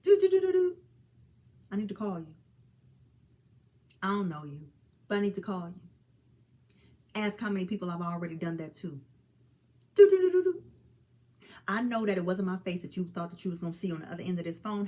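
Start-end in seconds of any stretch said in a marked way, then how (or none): 0.0-0.8 high loud imitated phone ring "do, do, do, do, do"; playful ringtone mimic; ringtone sing-song. 9.9-10.6 high loud imitated phone ring "do, do, do, do, do"; playful ringtone mimic; ringtone sing-song.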